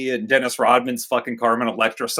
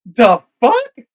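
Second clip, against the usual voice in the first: confused gorillas; 8 semitones higher, 6 dB louder than usual. Retold or imitated. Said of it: "And they're like".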